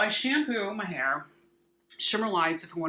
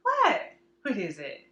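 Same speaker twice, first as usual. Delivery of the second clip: talking to a dog; 3 semitones higher than usual. Real, not acted. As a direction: squeal it at the dog. Speaking to the dog.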